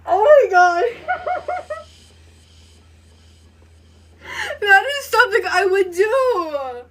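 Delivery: high, loud, laughing squeals; flustered squealing. Real, not acted. A fan losing it over the killer's flirting. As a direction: shriek with giddy overload. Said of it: "I'm too flustered".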